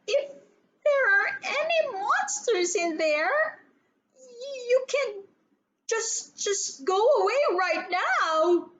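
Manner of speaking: high, halting, frightened little voice; a child begging the dark to go away; nervous gulping plea. A narrator's reading. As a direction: stammer it out, scared of the monsters. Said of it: "she gulped".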